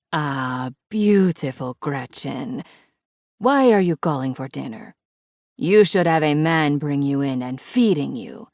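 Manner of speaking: Italian-accented scolding with a hint of laughter; bossy Italian grandma, amused; teasing Italian accent. A narrator's reading. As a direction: tease fondly in a thick Italian accent. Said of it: "There's a hint of laughter in her voice".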